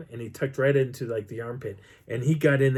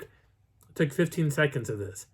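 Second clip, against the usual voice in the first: ordinary talk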